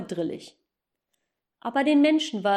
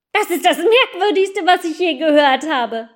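high, loud laughing amazement; amazed; 6 semitones higher, 10 dB louder than usual. Acted. Matz marvels at the strange name, loud and laughing. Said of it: "sagte Klein Matz".

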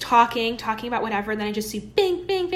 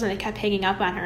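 ordinary talk

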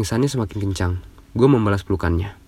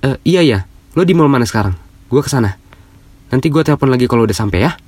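urgent; 4 semitones higher, 7 dB louder than usual. Acted. Friend alarmed, rushing to help.

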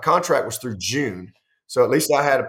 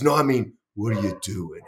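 big man's voice; 4 semitones lower than usual. Retold or imitated. Former strength coach quizzing young coach, lightly voiced. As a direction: drop into a big ex-linebacker's voice, lightly. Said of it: "he's got this voice".

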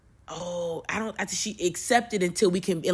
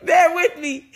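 emotional; 2 semitones higher, 4 dB louder than usual. Real, not acted. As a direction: plead for patience while feelings spill over. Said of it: "I'm so emotional, y'all".

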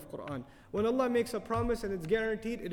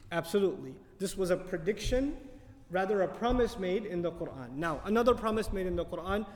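usual speaking voice

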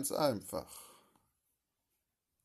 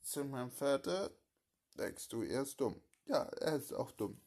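ordinary talk

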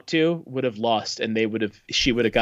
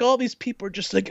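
usual speaking voice

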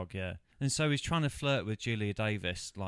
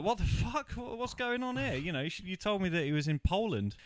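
much higher, baffled squawk of a man caught out; outraged Ferris; 10 semitones higher than usual. Retold or imitated. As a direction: play Ferris's shocked disbelief, pitched way up. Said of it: "Ferris is like".

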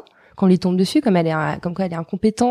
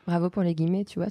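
ordinary delivery

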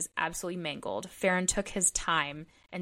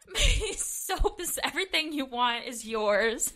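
hammed-up crying plea, much higher and slow at first; mock sobbing; 5 semitones higher, slower than usual. Pretending to beg Grant over the soap, hammed-up sob. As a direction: wail it like a melodramatic sob.

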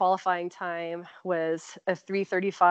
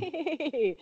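sheepish greeting; 8 semitones higher, 4 dB quieter than usual. Retold or imitated. Acting out greeting the fast runner.

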